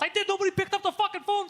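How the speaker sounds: very high exasperated outburst; comic worked-up rant; retold yelling. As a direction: protest at the top of your pitch. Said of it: "he's just yelling all the time".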